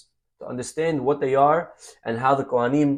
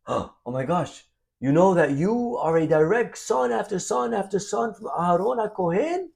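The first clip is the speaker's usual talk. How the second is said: high, breathless mock wonder, much higher; mock amazement; 7 semitones higher than usual. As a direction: gush in amazement at the man's lineage. Rabbi retells amazing the Kohen.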